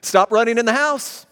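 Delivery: firm parental command; stern command. Shown as a demonstration. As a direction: call it out like a parent.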